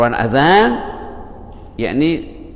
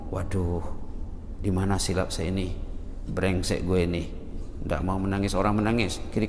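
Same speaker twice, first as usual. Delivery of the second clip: quiet self-reproach; 6 semitones lower, 10 dB quieter than usual. Acted. Preacher voices self-reproaching worshipper.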